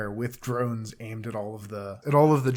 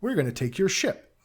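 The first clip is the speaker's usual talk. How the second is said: higher, gloating; smug threat; 7 semitones higher than usual. Retold or imitated. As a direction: lord it over them. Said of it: "very much like".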